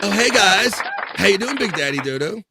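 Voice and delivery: high, loud, building welcome; hyped-up introduction. Real, not acted. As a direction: bring on a valued guest with a big hello. Host introduces viewer checking in.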